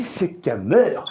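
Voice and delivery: usual speaking voice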